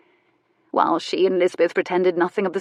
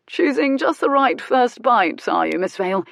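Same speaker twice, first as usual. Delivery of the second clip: sly teasing gentleman; 5 semitones higher, 3 dB louder than usual. Acted. Teasing gentleman speaks at breakfast.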